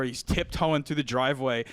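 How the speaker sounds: ordinary talk, nothing marked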